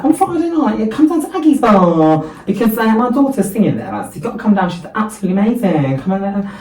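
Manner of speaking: high Essex voice, pushing the invite hard; loud, high-pitched Essex gushing; mock Essex mum. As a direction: impersonate a proud, chatty Essex mum. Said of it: "she's not from Essex, by the way, but she is now".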